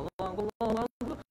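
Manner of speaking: high Allah calls, fading; mock bilal call. Imitating the bilal's sudden iqamah, high. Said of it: "komat langsung".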